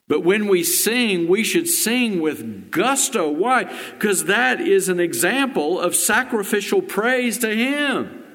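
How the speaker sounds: high, loud urging preacher; fervent preaching. Acted. Pastor urging the congregation to sing loudly, fervent preaching. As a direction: rouse them to sing with gusto.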